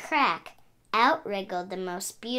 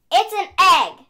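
insisting; 10 semitones higher, 11 dB louder than usual. Acted. Pig insists to Goose.

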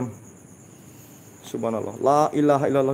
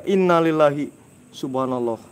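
ordinary talk, nothing marked

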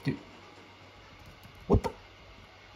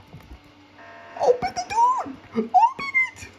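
very high, louder frantic plea; desperate shriek; 4 dB louder, slower than usual. Real, not acted. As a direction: scream to get the door open. Trapped, begging door opened.

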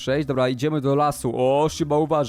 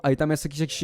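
ordinary delivery

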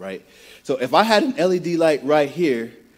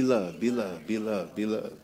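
soft repeated blessing; 5 semitones lower, 8 dB quieter than usual. Shown as a demonstration. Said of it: "To the person next to you".

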